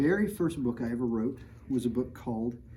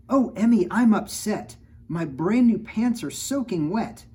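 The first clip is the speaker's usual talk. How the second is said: high, loud upset father's yell; dad yelling; 9 semitones higher, 9 dB louder than usual. Acted. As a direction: shout it like a dad whose new pants got soaked. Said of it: "Dad yelled".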